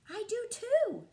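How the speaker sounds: high, loud squeal of delight; excited Lily. Acted. Lily to Chester at lunch, thrilled and high. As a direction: burst out thrilled. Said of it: "said Lily".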